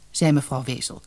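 plain reading voice

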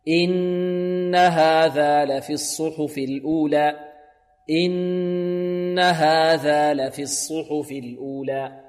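slow chant, long hum on the n of "إن"; correct recitation. Shown as a demonstration. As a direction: chant it evenly with a clean hamza on "إن". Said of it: "هكذا". (تلاوة مرتلة بهمزة سهلة في "إن")

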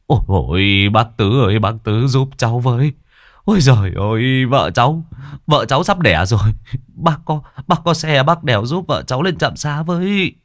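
breathless-style plea, pitch climbing as it goes; panicked. A narrator's reading. Husband begs neighbour for a ride to the clinic, panicked. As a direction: stumble over repeats, frantic for help. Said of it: "Vội vàng ông cất giọng hớt hải".

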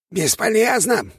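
high, slow snarling rat voice; bare your teeth and snarl it. Acted. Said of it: "оскалилась крыса со стрелой".

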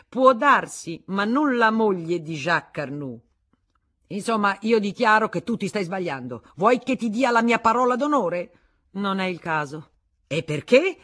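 high, very loud back and forth; heated quarrel. Acted. Husband and wife arguing, heated. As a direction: fling the words.

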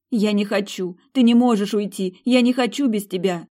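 mother begging her daughter not to leave, tearful cry; desperate pleading. A narrator's reading. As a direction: plead through tears not to let her go.

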